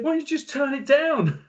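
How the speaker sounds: high-pitched mock yell; retold shout at the sound engineer; imitating shouting. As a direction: plead over the din, voice pushed right up. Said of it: "we were shouting at each other".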